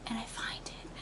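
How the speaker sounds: whispered, breathy storytelling reveal; secretive whisper for suspense; hushed discovery. Retold or imitated. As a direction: drop to a whisper for the find. Hushed climax of the search story.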